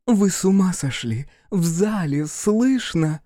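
high, urgent reproach; exclaimed rebuke, ending drawn out; alarmed scolding. Acted. Actress scolds the brawling men.

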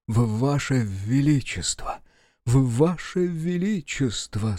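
slow, slightly stammered royal address; halting, drawn-out start to a speech. Acted. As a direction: stammer a little on the opening sounds. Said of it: "Первые слова он произносит чуть-чуть заикаясь".

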